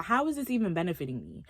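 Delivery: usual speaking voice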